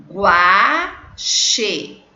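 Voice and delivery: "Guaxê" in two long, separated syllables; drawn-out classroom syllable division. Shown as a demonstration. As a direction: break "Guaxê" apart, holding the first syllable. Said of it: "Eu separei".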